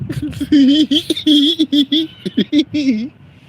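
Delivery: cackling high and loud; helpless shrieking laughter. Real, not acted. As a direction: burst out laughing. Laughs at package.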